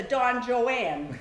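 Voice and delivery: "Don Joanne" with the last word like the woman's name; mispronounced name. Retold or imitated. Mother mocking father as a Don Juan, "Joanne" mispronounced jo-ANN. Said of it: "did not always pronounce words".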